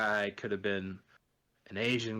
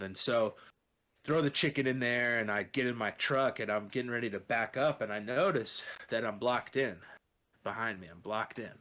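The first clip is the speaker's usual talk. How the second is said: normal talking voice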